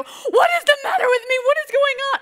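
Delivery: loud, high wailing into the phone; hammed-up tearful panic. Crying mom calling her own mother, hammed-up bewildered wail. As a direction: wail it in mock distress. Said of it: "So shocked I had to call my own mom".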